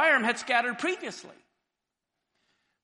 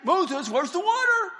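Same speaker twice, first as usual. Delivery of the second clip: much higher, loud repeated call; shrill nagging call; 10 semitones higher, 7 dB louder than usual. Acted. Crowd outside the tent calling Moses again, higher and louder. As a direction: pester him, higher and louder.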